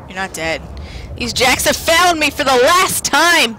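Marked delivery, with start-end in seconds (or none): 1.1-3.5 loud, high battle declaration; angry vow. Real, not acted. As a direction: shout it like a threat to the enemy. Vows revenge on game enemies.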